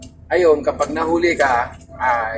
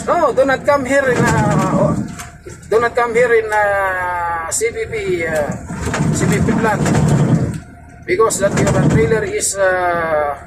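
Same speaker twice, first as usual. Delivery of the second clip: the boss barring the truck from the plant, hammed up; imitating the dispatcher; 6 semitones higher, slower than usual. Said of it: "sabi niya".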